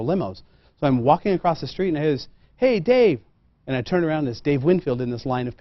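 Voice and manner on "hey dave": calling out. Retold or imitated. Calling across the street.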